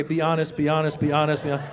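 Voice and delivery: quick, even repeated chant; mumble-free run of the same words, level; self-urging mantra. Retold or imitated. Father steadying himself to answer daughter, monotone mantra.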